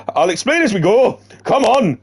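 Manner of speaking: high, loud mock Eastern European voice; hammed-up Eastern accent, urging on; Igor's accent. Igor telling Sam to hurry, in a mock Eastern accent. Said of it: "That accent I did sounded Eastern European".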